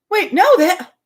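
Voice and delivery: mock disbelief. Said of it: "her just being like".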